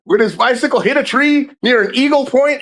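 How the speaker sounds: high-pitched fake news bulletin; mock insistence. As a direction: insist on the joke, pitched well up. Joke report of Popeye's death.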